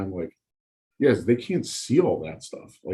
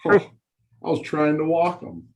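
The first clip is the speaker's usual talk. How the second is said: higher, feigned innocence; mock sarcastic; 6 semitones higher than usual. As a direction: play the innocent to a heckler. Sarcastic reply to heckler.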